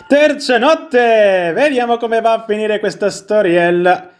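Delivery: high, drawn-out announcer flourish; showy announcement. Pretend. Player announcing the third night, high and theatrical. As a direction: sing out the title well above normal pitch.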